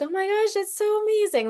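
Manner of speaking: high, thrilled gush of surprise; overjoyed, pitch leaping up; delighted gasp. Retold or imitated. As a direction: squeal it like a lovely surprise just landed. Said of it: "And I was like".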